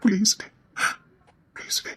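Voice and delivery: soft, near-whispered plea; hushed pleading. Acted. Husband begging his wife to stop working, hushed pleading. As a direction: beg under your breath, tearfully.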